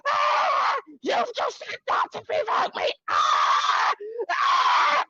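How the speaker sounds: very high screamed accusation between shrieks; imitated screaming. Guest imitating a man's meltdown in people's gardens, screaming. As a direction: scream it out, imitating someone losing control. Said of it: "Like, proper, like, screaming".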